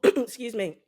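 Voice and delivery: ordinary delivery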